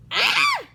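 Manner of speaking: high, loud little-rabbit shriek; short startled scream. Acted. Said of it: "shrieked Littlest Rabbit".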